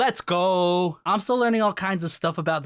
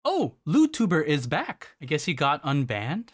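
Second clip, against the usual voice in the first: ordinary delivery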